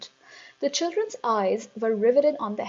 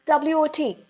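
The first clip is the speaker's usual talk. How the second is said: put-on higher, hasty boy; quick answer; 7 semitones higher, 6 dB louder than usual. A narrator's reading. Eric answering too quickly, higher and hasty. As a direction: blurt the letters out. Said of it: "answering too quickly".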